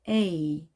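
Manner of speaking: A drawn out as a gliding ay; long vowel. Shown as a demonstration. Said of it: "제 이 소리는 우리가 장모음". (A를 '에이'로 길게)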